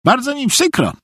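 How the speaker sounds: earnest high-voiced fool; very grave simpleton. A narrator's reading. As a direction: apologise with great seriousness, high-pitched. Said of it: "odpowiedział Szwajk z wielką powagą".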